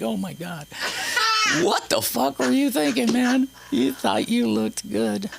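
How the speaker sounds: high, mocking voice scolding his younger self; mock horrified self-scolding. Looking at high school pictures, mocking his old self high and laughing. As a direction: sneer at the old photo in a high voice. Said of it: "and it's like".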